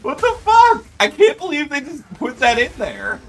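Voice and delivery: high, loud disbelief; shrill outrage. Real, not acted. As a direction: squeak out your indignation. Outraged reaction to game insult.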